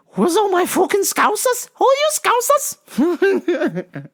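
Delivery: very high, squeaky Scouse shout; hammed-up falsetto Scouse; butchered Liverpool accent. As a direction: squeal it in bad Scouse, drawn out at the end. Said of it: "the Liverpool accent".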